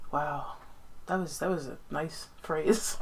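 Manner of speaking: soft, low murmur of admiration; sit back and softly marvel, recalling it; quiet awe. Retold or imitated. Retelling her awed reaction while reading.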